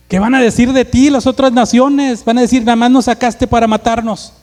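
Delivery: loud, high, impassioned protest; urgent plea. Acted. Moses pleads for the people before God, loud and urgent.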